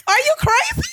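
high-pitched, loud, hammed-up shock; squealed mock outrage; incredulous outrage. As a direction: cry it out, appalled. Retold outrage at mother.

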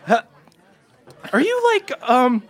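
put-on high, hesitant boyish voice; nervous halfling. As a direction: ask shyly, voice way up. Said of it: "he's really super nervous and he goes".

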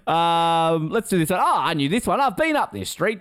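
ordinary talk, nothing marked

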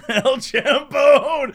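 slow, laughing El Champone; mock boss line. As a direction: repeat the botched boss line, giggling. Repeating the dream line.

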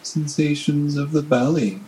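normal talking voice

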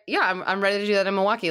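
ordinary talk, nothing marked

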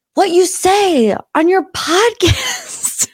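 high, slow exasperated outburst; acted-out exasperated husband; frustrated. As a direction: drag the words out in imitated frustration. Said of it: "getting so frustrated".

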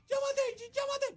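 orden desesperada en voz agudísima, imitada; Fabi en pánico. Retold or imitated. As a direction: imita a la esposa pidiendo ayuda a gritos. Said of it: "Fabi se me tira encima". (chillido exagerado de urgencia)

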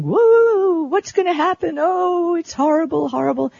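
high-pitched wailing mock alarm; mock whining panic. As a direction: wail it like an overblown doomsayer. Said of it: "Engadget is whining".

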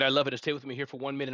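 usual speaking voice

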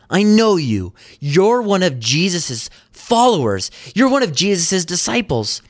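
retold accuser pressing home an identification; acted accusation. Someone spots Peter by the fire, mock accusing.

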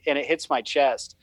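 usual speaking voice

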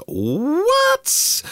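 very high, incredulous squeal; high-pitched astonished yelp; mock amazement. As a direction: react in playful disbelief, pitch shooting right up. Mock amazement at horsepower figure.

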